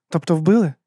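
high, pointed question; taken aback. A narrator's reading. Abena asks, taken aback.

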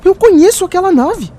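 bursting out as he recognizes it; excited recognition. Acted. (animado, bem mais alto e agudo)

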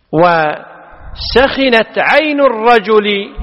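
"وسخنت" said wasakhinat, i on the kh, spelled out slowly; "وسخنت عين الرجل" slow, with kasra; بكسر الخاء; kasra on kha. Shown as a demonstration. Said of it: "هذا واحد فقط وهو بالكسر".

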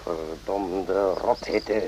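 low, slow, soft grumble; muttered curse. Acted. Doctor curses the heat to himself, low and slow. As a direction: drag it out, wilting in the heat.

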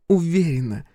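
sly teasing female voice; Viv's voice. Acted.

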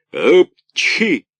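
high, loud sneeze burst; loud theatrical sneeze. A narrator's reading. Karabas sneezing while scolding, loud sneeze. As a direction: let the sneeze explode.

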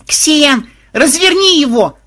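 high, loud desperate shout; yelling. A narrator's reading. Granddaughter yells to the programmer, high shriek. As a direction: shriek the order across the cave. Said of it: "завопила внучка".

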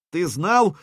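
shouting. Acted. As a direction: shout into his ear. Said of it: "крикнул в ухо Сергею".

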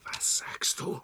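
whispered in disbelief; stunned whisper. Acted. Clown whispering, shocked at the request. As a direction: breathe it out, shocked.

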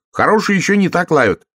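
high, loud huffy retort; offended little frog. Acted. Little frog defends its barking to the hare, offended. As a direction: insist in a high, hurt voice. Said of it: "обиделся лягушонок".

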